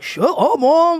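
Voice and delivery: high, loud whiny shut up mom; bratty teenager. Pretend. Friend mocking a rude teenager, high and whiny. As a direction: whine it like a snotty teen. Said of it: "a shitty teenager about it".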